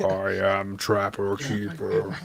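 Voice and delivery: slow, deadpan robot voice. Acted. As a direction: announce it like a menacing computer. Quoting South Park robot.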